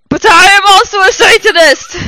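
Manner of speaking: very high, loud mock-furious cry; angry declaration. A narrator's reading. Hagrid revealing he is a Satanist, hammed-up loud. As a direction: proclaim it with hammy rage. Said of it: "paused angrily".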